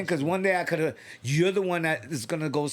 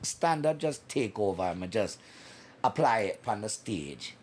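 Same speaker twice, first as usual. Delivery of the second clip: put-on Jamaican accent; 5 semitones lower than usual. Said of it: "in a Jamaican accent".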